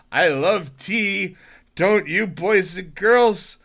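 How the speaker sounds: a hint of Mister Rogers, slow; gently slow it for the kids; lightly voiced children's host.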